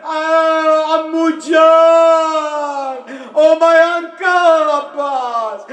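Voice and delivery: stretched, sung-out cry to her uncle; keen it like a terrified little girl; child's wailing plea. Acted. Sakina calling her uncle Abbas, high sung wail. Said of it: "And Sakina says".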